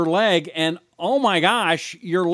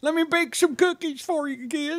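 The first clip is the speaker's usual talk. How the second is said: put-on high, soft old granny voice; old lady voice; 10 semitones higher, 4 dB quieter than usual. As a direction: talk like a frail little grandma. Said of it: "an old lady voice today".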